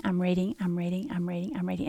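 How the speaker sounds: trailing off into a whisper; fading whisper. Retold or imitated. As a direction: sink into the book, whispering. Absorbed in the book, fading.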